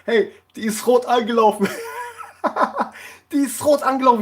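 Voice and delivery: can barely get it out for laughing; laughing while telling. Real, not acted. Vlogger cracks up recalling the blushing baker, laughing. Laughing at his own story.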